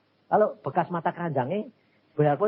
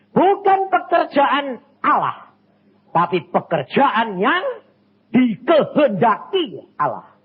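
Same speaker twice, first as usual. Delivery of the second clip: slow, loud and higher, every word hammered; preacher insisting to the congregation; forceful preaching; 7 semitones higher, 6 dB louder than usual. Real, not acted. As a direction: drive the distinction home. Preaching the key distinction.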